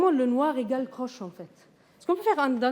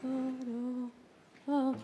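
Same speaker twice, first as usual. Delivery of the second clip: light, no accent; 7 dB quieter, much slower than usual. Shown as a demonstration. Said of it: "I will call them a little light".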